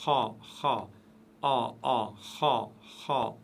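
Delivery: "Ha" said with a full, rounded mouth; "ha" dark and heavy, mouth full. Shown as a demonstration. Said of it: "la bouche est pleine".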